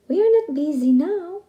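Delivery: high, loud froggy reply. Acted. Frogs telling the rooster, high and loud.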